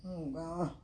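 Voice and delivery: usual speaking voice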